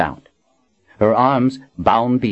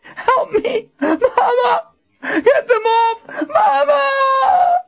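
high, crying shrieks of terror; shrieked; 3 dB louder, much slower than usual. A narrator's reading. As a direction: build to a crying scream for mother. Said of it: "she shrieked".

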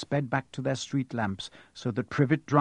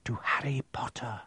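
hushed whisper; 3 semitones lower, 9 dB quieter than usual. A narrator's reading. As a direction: whisper it like a secret toast. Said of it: "saying in hushed voices".

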